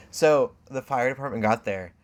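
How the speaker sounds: ordinary talk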